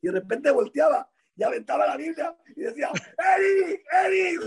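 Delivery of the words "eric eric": high, drawn-out mock shout; retold yelling. Stanky calling Eric after the prank, retold yelling. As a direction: cry out the name as if in panic. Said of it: "Se era gritando".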